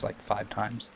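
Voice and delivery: ordinary talk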